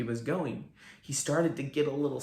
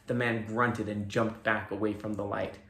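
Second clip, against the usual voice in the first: ordinary delivery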